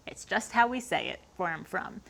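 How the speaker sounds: ordinary talk, nothing marked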